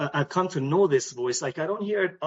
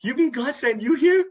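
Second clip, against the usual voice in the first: amazed wife; 8 semitones higher, 6 dB louder than usual. Retold or imitated. Wife amazed, God sent him.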